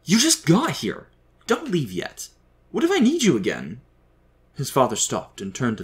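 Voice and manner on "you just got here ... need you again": high, raised, pleading voice; desperate plea, pitched way up; frantic pleading. A narrator's reading. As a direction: plead frantically with someone not to leave. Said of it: "Sam said frantically".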